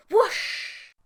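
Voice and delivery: high loud whoosh; whoosh sound effect. A narrator's reading. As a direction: make the rush of air. Sound of rushing air.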